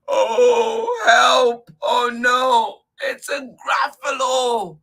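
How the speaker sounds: high, loud cry for help; hammed-up frightened squeal; alarmed cry. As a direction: shriek out in storybook panic. Mouse meets the monster in the story.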